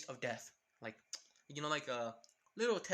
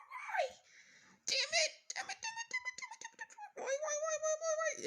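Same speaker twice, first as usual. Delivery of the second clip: internal screaming. Acted. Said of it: "screaming and cursing at herself".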